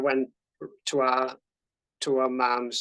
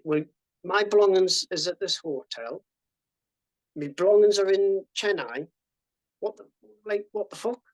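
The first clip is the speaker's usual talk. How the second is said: retold outrage; 5 semitones higher, 5 dB louder than usual. Estonians outraged at the verdict.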